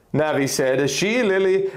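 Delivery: ordinary delivery, nothing marked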